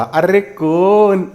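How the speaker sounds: usual speaking voice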